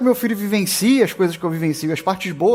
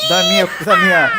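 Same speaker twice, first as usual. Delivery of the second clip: squeaky slip; 5 dB louder than usual. Real, not acted. Erra e sobe a voz rindo.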